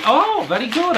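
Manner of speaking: delighted high exclamation; excited planning. Real, not acted. Recognising the second-hand book.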